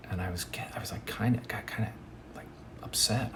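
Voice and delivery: hushed, whispered admission of being upset; sheepish whisper; whispered confession. Real, not acted.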